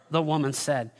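ordinary talk

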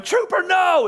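high, loud cry of the dog's name; retold yell. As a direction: shout it like the girl next door. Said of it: "our neighbor girl yelling".

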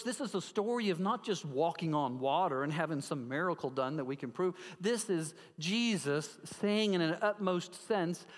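ordinary talk, nothing marked

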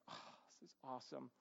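very soft, secretive marvelling; hushed awe. Acted. Boy alone examining stolen coins, hushed acted awe. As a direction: breathe it out almost under your breath.

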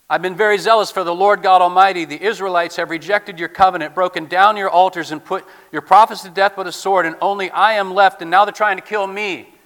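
loud, impassioned Elijah complaint; forceful lament. Acted. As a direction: pour out the grievance at full voice.

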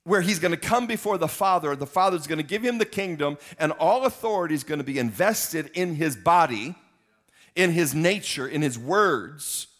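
loud, high preaching push; full-force preaching. Acted. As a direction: preach it out over the crowd.

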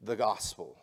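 ordinary delivery

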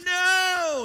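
a high, loud despairing cry; child crying out when the chain lock stops him; anguished no. Retold or imitated. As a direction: wail it as the chain catches. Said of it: "I was like".

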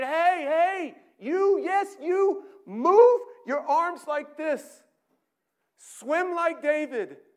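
loud, high, bossy calling from the bank; shouting instructions. Acted. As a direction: bark orders at the swimmer. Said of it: "shouting instructions to you".

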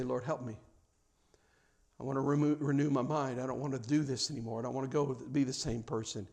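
soft, low, earnest plea to God; quiet prayer. Retold or imitated. Frustrated parent quietly praying for help, soft and sincere. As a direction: pray it under your breath, sincerely. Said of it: "And you say a prayer".

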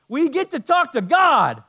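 high, loud burst of wonder; awed exclamation. Real, not acted. Preacher marvelling at talking with God, loud and high. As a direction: cry it out in amazement.

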